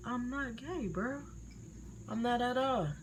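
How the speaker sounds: high, soft mock protest; insistent denial. Child insisting to mom, imitated soft high denial.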